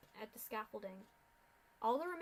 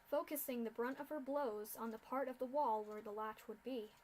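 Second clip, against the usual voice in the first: usual speaking voice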